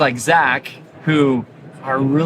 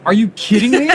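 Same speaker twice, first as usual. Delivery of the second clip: incredulous; 6 semitones higher, 4 dB louder than usual. Retold or imitated. Doctor's disbelief at patient's question.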